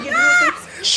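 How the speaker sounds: high laughing shout. Real, not acted. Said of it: "laughing shout".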